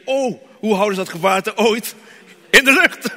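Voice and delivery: rising, loud wonder; amazed disbelief. Retold or imitated. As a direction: exclaim in disbelief. Imagined amazed thought, loud.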